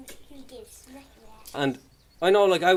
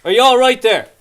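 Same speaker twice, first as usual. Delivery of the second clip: policeman; 5 semitones higher, 9 dB louder than usual. Retold or imitated.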